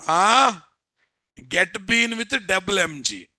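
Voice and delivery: usual speaking voice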